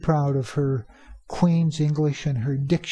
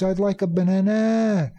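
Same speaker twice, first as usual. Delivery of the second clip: "banana" ending on a long stressed NAA; Thai learner's stress; 3 semitones higher than usual. Acted. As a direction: imitate a learner stretching the last syllable of "banana". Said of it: "If a student says".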